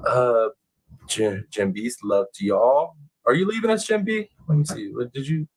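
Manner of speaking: usual speaking voice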